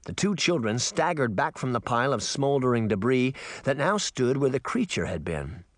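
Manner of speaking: ordinary delivery, nothing marked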